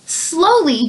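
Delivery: ordinary delivery, nothing marked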